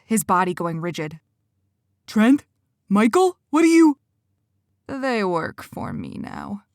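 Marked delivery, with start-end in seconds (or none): 2.1-4.0 loud, much higher, rising to a cry; shocked disbelief. Acted. Benny sees his friends among the wolves, loud shocked cry. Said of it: "Benny gasped". 4.9-6.6 slow, softer, grinning menace; smug villain. Acted. Quentin taunts Cora about his new wolves, slow and smug. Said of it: "Quentin said with a wide grin".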